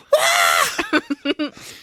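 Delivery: piercing high mock wail; long, very high fake cry; hammed-up child's wail. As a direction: bawl like a lost little girl. Lost girl's wail, acted.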